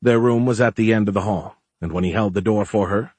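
ordinary delivery, nothing marked

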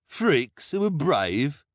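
loud, slow, incredulous question; sneering. A narrator's reading. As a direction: draw it out with high-pitched scorn. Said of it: "the hook-handed man sneered".

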